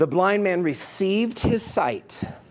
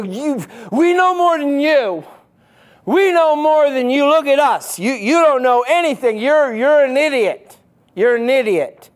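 louder, higher huffy sneer at the blind man; huffy mock anger; 7 semitones higher, 6 dB louder than usual. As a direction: puff up and scold like smug Pharisees, arms crossed. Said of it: "They get all huffy puffy".

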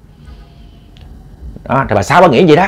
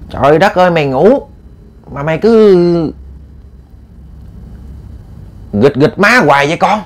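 higher-pitched motherly griping; exasperated complaint; 5 semitones higher than usual. Acted. Mother complaining of daughter's fidgeting at night, acted exasperation. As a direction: complain with pitch held high throughout.

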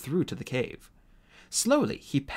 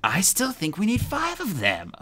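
snapping; 9 semitones higher than usual. A narrator's reading. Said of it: "Webb snapped".

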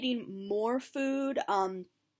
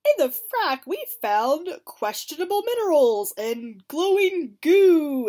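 higher, louder announcer-style read; mock video narrator; 6 semitones higher, 9 dB louder than usual. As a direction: play up the promo video voice. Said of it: "and it's like".

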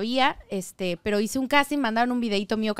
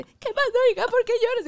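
mother crying; 12 semitones higher, 3 dB louder than usual. Retold or imitated. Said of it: "Solo lloró conmigo".

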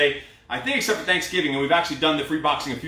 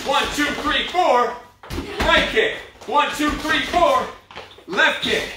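pumped-up coach calling; 7 semitones higher, slower than usual. Acted. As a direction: fire up the class through the last round. Coach calls burnout round.

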